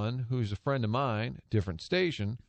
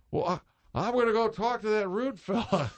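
put-on much higher old announcer voice; Harry Caray; 10 semitones higher than usual. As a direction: play the grumpy old announcer. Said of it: "I hear him say".

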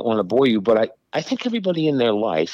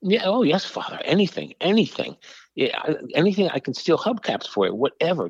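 higher, eager begging, lightly acted; mock-grovel to the priest for the signature; desperate pleading; 7 semitones higher than usual. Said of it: "was what I was thinking".

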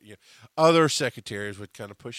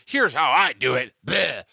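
louder, snooty know-it-all line; mock know-it-all; 2 semitones higher, 9 dB louder than usual. Mocking a newcomer who insists on their way, snooty. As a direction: sneer it like a pushy newcomer.

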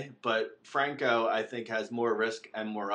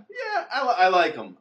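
excited; 7 semitones higher, 7 dB louder than usual. Acted. Joking excited praise of player.

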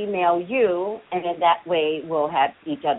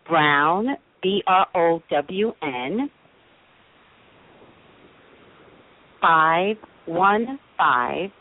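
dictating slowly; slower than usual. Real, not acted. Dictating her email to caller.

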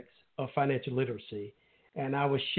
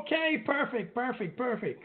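relieved excitement; 10 semitones higher than usual. Real, not acted. Guest finally connects.

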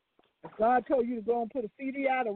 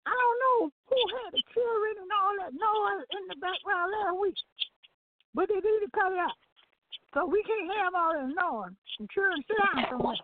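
chewing while talking; 7 semitones higher than usual. Real, not acted. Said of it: "you sound like you chewing on some crackling".

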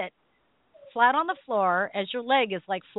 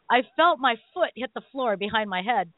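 usual speaking voice

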